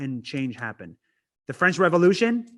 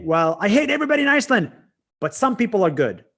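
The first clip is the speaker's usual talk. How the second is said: higher, louder, fast grudging concession; hypothetical inner voice; 6 semitones higher, 5 dB louder, faster than usual. Retold or imitated. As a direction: rattle it off like a half-converted grump. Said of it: "Then I'll say".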